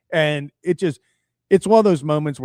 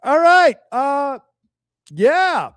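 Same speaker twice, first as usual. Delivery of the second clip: far higher, louder, stretched words; hammed-up slow shout; 13 semitones higher, 7 dB louder, much slower than usual. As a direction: bellow it slowly to a stadium that can't hear you. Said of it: "I probably also".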